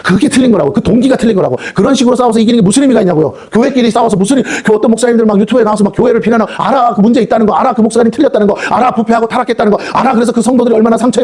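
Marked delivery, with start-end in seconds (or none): none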